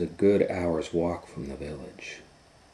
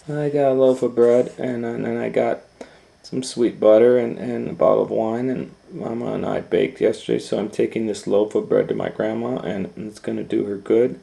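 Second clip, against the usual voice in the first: put-on higher, loud stammering little girl; eager little girl; 5 semitones higher, 11 dB louder than usual. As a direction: rattle off the basket excitedly. Girl lists basket contents.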